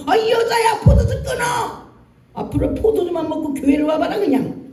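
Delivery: high shouted rebuke, hammed up; mock drunk-scolding. Pastor mockingly scolding Hannah as drunk, shouted high. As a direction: yell at her like a rude old priest. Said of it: "이렇게 해버린 거야, 그 목사가".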